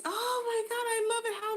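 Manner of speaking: very high, gushing squeal over the earrings; a thrilled customer shrieking with joy; squealing delight. Pretend. Pretend customer squealing over handmade earrings, high and gushing.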